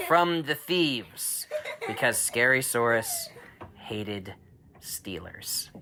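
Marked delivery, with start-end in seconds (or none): 3.8-5.7 hushed ominous whisper. A narrator's reading. Dad whispering Scary Saurus's hatred of thieves. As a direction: breathe it like a dark secret.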